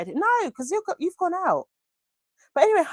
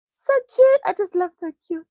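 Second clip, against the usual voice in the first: gushing; 8 semitones higher, 4 dB louder than usual. Real, not acted. Gushing over chat message.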